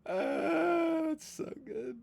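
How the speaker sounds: high, breathless laugh-cry. Real, not acted. Streamer wheezing at the game, laughing high and soft. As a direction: squeak it out through tears of laughter.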